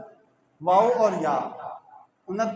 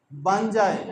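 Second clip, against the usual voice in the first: ordinary delivery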